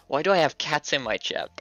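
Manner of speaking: ordinary delivery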